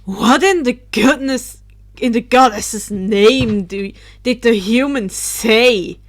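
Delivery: loud startled outburst; exclaimed. Acted. Drake bursts out loudly as he hovers down. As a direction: demand it loudly from above. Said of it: "Drake exclaimed".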